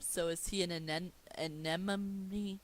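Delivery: stumbles over "anemone", drawn out slowly; tripping on "anemone"; struggling with a word. Real, not acted. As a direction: fumble through "anemone" syllable by syllable. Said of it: "why can't I say that word?".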